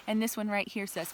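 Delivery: plain reading voice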